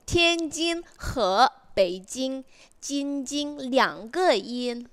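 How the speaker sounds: slow, word-by-word model pronunciation of "Tianjin he Beijing"; corrected Mandarin reading. Shown as a demonstration. Tutor showing the learner the right reading, slow careful pronunciation. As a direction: draw out each syllable of "Tianjin he Beijing" carefully. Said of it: "coba kamu benerin".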